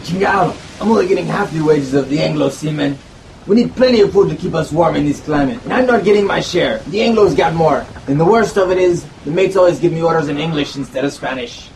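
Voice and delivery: put-on a Mexican-accented seaman demanding his share. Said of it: "A Mexican sailor chimed in".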